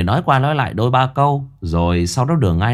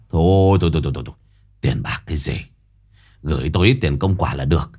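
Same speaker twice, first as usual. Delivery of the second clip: lower, mock-modest old sorcerer; sly old master; 7 semitones lower than usual. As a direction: wave off the money, then name a price. Quý waving off money.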